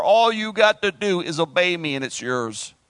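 ordinary talk, nothing marked

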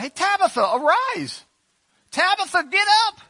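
high, loud, breezy call; casual Peter calling out. Retold or imitated. Preacher acting Peter casually telling Tabitha to get up, high and loud. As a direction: toss it off like a buddy waking a friend. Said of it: "I just see Peter walking around".

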